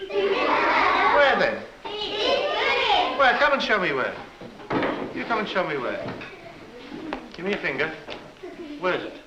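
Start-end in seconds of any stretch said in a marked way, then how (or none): 0.1-4.1 teasing. Real, not acted. Teasing child about nose.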